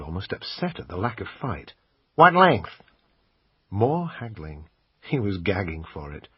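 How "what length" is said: a higher, louder American salesman pitching the camera; pawn shop salesman. Acted. Assistant pitching the camera to the customer, salesman voice. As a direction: sell it like a pushy shop assistant.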